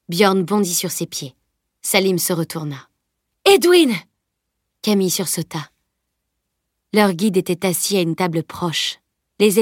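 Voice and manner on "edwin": high, loud exclamation of recognition; startled cry. A narrator's reading. Friends suddenly spot their guide behind them, startled cry. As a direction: call the name out in surprise.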